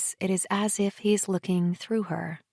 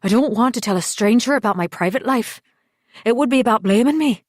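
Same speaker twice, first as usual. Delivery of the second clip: defensive anger; 3 semitones higher, 7 dB louder, faster than usual. A narrator's reading. Husband refuses counselling, defensive.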